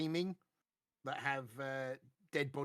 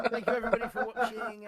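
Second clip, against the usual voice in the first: laughing; 6 semitones higher, 12 dB louder than usual. Real, not acted. Still laughing after the goodbye.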